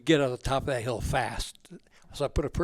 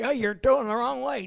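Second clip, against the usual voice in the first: lightly voiced nagging mother, higher; a hint of mom; 9 semitones higher than usual. Retelling car arguments with Mom, a light mock nag. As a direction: toss off a mock complaint about the driving. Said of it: "I'd say".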